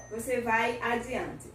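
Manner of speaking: ordinary delivery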